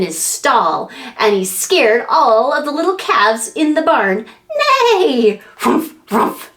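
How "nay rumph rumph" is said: high neigh; whinny like a pony; horse neigh. Acted. Voicing a startled pony for a child, high neigh.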